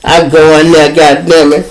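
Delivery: ordinary talk, nothing marked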